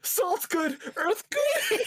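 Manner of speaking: mocking through laughter. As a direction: squeal it out between laughs. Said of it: "Why salt?".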